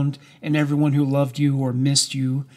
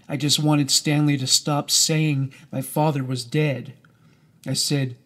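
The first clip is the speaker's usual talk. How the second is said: usual speaking voice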